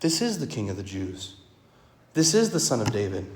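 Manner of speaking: preacher affirming the title as true; sincere. Shown as a demonstration. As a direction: say it softly as plain truth. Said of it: "it's a true statement".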